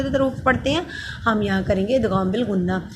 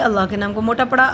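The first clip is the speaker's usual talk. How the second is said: usual speaking voice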